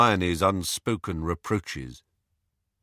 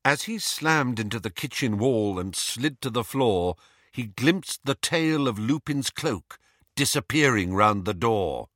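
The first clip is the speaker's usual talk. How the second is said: ordinary delivery, nothing marked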